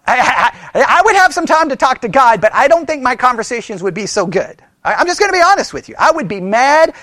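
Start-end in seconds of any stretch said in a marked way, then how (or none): none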